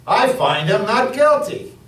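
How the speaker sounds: high, loud "not guilty"; emphatic insistence. A narrator's reading. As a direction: insist against the crowd. Pilate insists again, raised.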